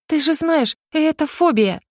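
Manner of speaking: high-pitched, plaintive self-defence; plaintive protest. A narrator's reading. Shaken heroine excusing her phobia to the hedgehog, plaintive.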